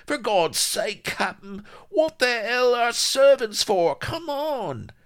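high, strained shouting; exasperated snarl. Acted. Vladimir urging his captain to flee, exasperated snarl. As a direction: shout it urgently, at the end of your patience. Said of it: "snarled Vladimir".

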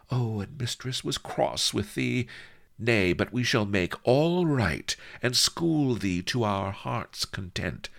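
fondly teasing, high-voiced mistress. A narrator's reading. Mistress fondly coaxes him after sigh.

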